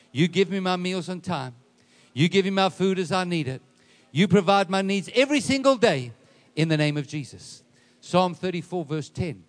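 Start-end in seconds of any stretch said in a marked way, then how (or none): 0.1-7.6 loud, building call-out; preacher's declaration. Acted. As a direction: lead the congregation, pushing louder line by line. Leads congregation in a declaration.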